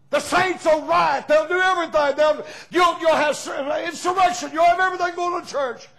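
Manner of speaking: high, loud shouting preacher; full-force revival preaching. Acted. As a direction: whip the congregation up, calling out.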